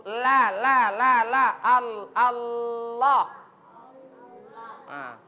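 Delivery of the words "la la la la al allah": loud repeated La building to Allah; lam drill. Shown as a demonstration. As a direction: drill la la la then slow Allah. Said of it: "dekat gigi dia". (latihan huruf lam lalu Allah)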